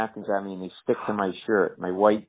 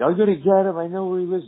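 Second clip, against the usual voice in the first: put-on drunk threat; 10 semitones higher, 4 dB louder than usual. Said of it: "He was like".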